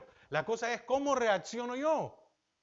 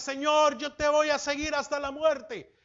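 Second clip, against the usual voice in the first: raised, loud, fervent promise to the Lord; Peter's bold vow; 8 semitones higher, 9 dB louder than usual. Retold or imitated. Preacher acting out Peter promising the Lord, loud and fervent. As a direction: swear loyalty with big confidence.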